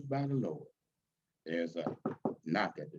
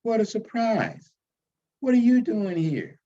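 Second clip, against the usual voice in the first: more demure; 5 semitones higher, 4 dB louder than usual. Acted. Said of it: "Her manner changes, more demure".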